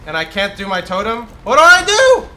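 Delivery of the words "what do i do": high, loud urgent yells; frantic shouting. Real, not acted. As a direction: bark orders in a real panic. Panicked commands during boss fight.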